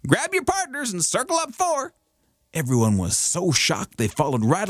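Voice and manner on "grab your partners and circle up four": high square dance holler. Acted. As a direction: call it out like a dance caller.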